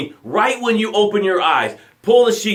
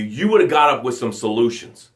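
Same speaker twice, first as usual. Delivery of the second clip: ordinary delivery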